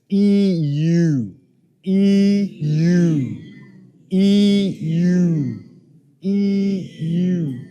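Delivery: exaggerated mouth drill "E U.". Shown as a demonstration. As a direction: stretch "E" then pout into "U". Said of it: "so it'll sound like this".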